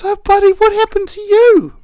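high, loud mock-dismayed concern; hammed-up sympathy, pitched high; concerned rescuer. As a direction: coo loudly over someone who is crying. Kind rescuer finds crying cell.

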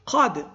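Qad called out high and loud on its own; teacher spotlighting Qad; stressed word. Shown as a demonstration. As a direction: hit the word Qad so students notice it.

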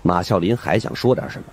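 ordinary delivery, nothing marked

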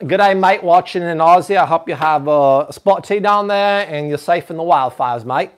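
hammed-up Aussie greeting accent; Australian accent. Shown as a demonstration. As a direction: put on a broad, amateur Australian accent. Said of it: "hear [name] do an Australian accent".

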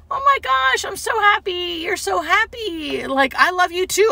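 high, effusive affection; gushing happiness. Retold or imitated. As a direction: gush back to the delighted patient. Playing along with patient's joy.

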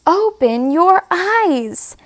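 usual speaking voice

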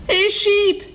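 high-pitched call to someone off. A narrator's reading. Coyote hails Mule Deer for help, calling out. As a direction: call out high, trying to get attention. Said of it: "he called out".

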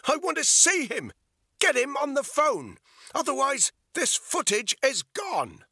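very high, loud demanding shout; desperate shout. A narrator's reading. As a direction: scream the threat at him. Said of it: "she shouted".

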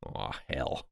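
ordinary delivery

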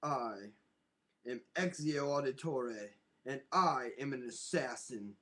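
Ezio Auditore. Pretend. Poses as Ezio with redhead wig.

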